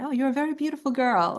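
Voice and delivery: mock English accent compliment, lightly put on. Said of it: "he said with that English accent".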